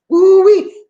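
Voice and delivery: high loud whoop; high loud woo-wee; excited exclamation. Real, not acted. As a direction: whoop in amazement. Exclaiming about Detroit people.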